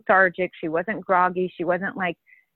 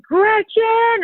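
high, loud yell of a name; retold scream; 14 semitones higher, 6 dB louder than usual. Husband yelling for his wife at midnight, loud panicked call. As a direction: shout the name in alarm. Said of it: "scream from the room".

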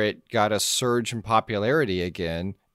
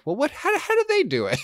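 high curious voice; faster than usual. Retold or imitated. Voicing people processing the fear.

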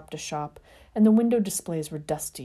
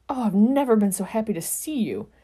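relieved; 5 semitones higher, 6 dB louder than usual. A narrator's reading. Relief at seeing friend.

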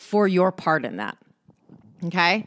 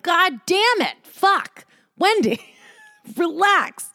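frustrated self-scolding; 9 semitones higher, slower than usual. Retold or imitated. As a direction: snap at yourself, then rein it in. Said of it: "I'm like".

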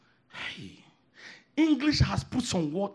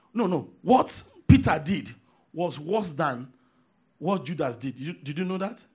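normal talking voice

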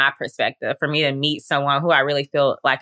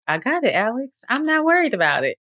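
higher, faster easygoing God, lightly voiced; calm God voice; 8 semitones higher than usual. God reassuring Alex he has it, a lightly voiced breezy God. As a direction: toss it off like an unbothered God.

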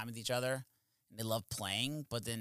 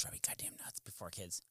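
whisper; 7 semitones lower, 14 dB quieter than usual. Real, not acted. Aside about wife.

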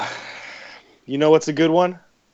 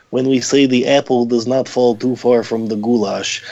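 put-on slow East European accent; father's East European accent; 4 dB louder, slower than usual. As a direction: imitate an East European dad. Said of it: "that is because I'm imitating my father".

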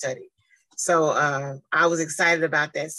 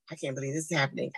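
softer and fast, stunned murmur; quiet fast disbelief; 3 semitones lower, 7 dB quieter, much faster than usual. Retold or imitated. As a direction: rush it softly in awe. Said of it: "I was sitting here like".

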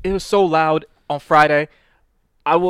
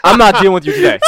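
loud, higher, amused protest; laughing exasperation; 5 semitones higher, 8 dB louder, faster than usual. Real, not acted. Laughing while fending off interruptions.

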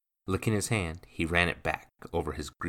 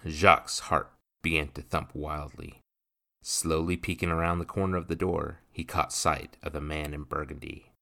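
usual speaking voice

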